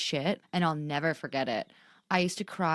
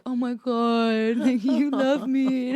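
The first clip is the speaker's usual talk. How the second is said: high, loud, drawn-out mock wail; exaggerated gushing, half laughing; imitated emotional partner; 7 semitones higher, 7 dB louder, much slower than usual. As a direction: play him overcome, slow and hammed up. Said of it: "And he's like".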